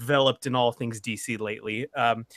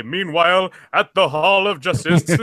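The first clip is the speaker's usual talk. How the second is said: put-on loud, old-time announcer parody; big, booming thirties broadcast delivery; cheesy radio announcer; 3 semitones higher, 9 dB louder, slower than usual. Podcast host opening the show as a superhero serial, cheesy announcer. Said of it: "nineteen thirties radio announcer voice".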